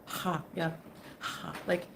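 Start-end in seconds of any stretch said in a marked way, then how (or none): 0.0-0.4 "Ha" pushed out on a breath; breathy Arabic h. Shown as a demonstration. Showing the Arabic sound to viewers, breathy h on "Ha". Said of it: "like a breathy". 1.2-1.5 soft, airy "Ha"; breathy Arabic h. Shown as a demonstration. Trying the Arabic sound once more, soft breathy "Ha". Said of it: "like a breathy".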